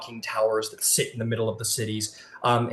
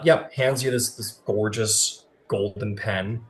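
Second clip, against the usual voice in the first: ordinary talk, nothing marked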